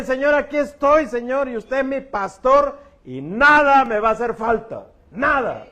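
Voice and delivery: loud, high, slow exhortation; fiery preaching. Acted. Pastor urging the congregation to surrender, at full force. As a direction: preach it out, pleading.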